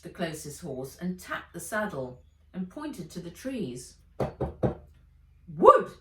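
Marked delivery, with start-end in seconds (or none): 5.5-5.9 exclaiming. Acted. Fred points at the trees.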